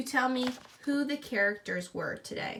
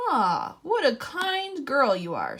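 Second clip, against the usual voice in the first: a slow, louder wolf purring a compliment; sly smiling wolf; 3 semitones higher, 6 dB louder, slower than usual. Acted. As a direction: flatter her slowly like a sly wolf. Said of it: "smiled the wolf".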